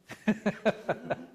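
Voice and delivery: short burst of real laughter; quick amused chuckling. Real, not acted. Laughs at the trolling remark.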